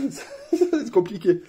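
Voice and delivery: high, laughing through the words; amused dismay. Real, not acted. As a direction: giggle it out. Laughing at difficulty.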